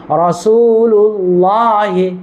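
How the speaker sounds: রাসূলুল্লাহি chanted very slowly, drawn out; model pronunciation. Shown as a demonstration. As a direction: stretch রাসূলুল্লাহি slowly with a thick la. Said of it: "কি উচ্চারণ?".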